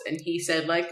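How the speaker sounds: ordinary talk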